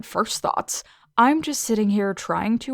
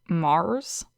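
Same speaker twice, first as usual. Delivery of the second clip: disbelieving echo. A narrator's reading. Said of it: "She said this as if it were obvious".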